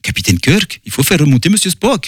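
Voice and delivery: cartoonish Russian accent, pitch climbing at the end; over-the-top fake Russian, rising high; Monsieur Tchekhov. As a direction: imitate a clichéd Russian accent. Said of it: "l'accent de Monsieur Tchekhov".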